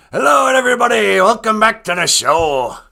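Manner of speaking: shouted, high, fast show opener; booming talk show welcome; loud host greeting. Acted. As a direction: hit the welcome like a big TV host.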